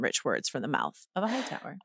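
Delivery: soft, low throwaway repeat of a quote; quiet aside. Acted. Host repeating the email's line, quiet aside.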